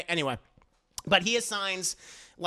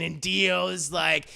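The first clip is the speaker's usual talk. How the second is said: ordinary delivery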